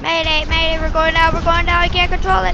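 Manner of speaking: mock mayday. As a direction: ham up the radio call as the plane goes down. Mock mayday while crashing.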